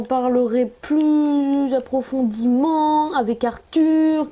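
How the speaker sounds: slow, effortful words; struggling to speak. Real, not acted. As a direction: push each syllable out slowly. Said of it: "j'ai réussi à parler". (parole lente et laborieuse)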